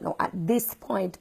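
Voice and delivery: ordinary talk, nothing marked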